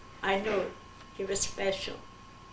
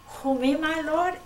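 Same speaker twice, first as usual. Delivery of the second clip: surprised question; 7 semitones higher than usual. Retold or imitated. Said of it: "he says".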